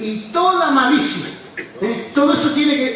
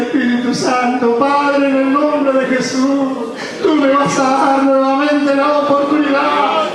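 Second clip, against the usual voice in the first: slow, rising pleading prayer; fervent prayer; 6 semitones higher, slower than usual. Acted. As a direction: pray it out, reaching higher with each plea. Leads a prayer.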